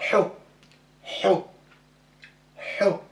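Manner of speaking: Ho breathed from the throat; throaty ho. Shown as a demonstration. Teacher demonstrating ha with dhamma. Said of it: "avec dhamma".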